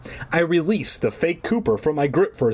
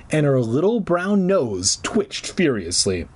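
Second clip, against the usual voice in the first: plain reading voice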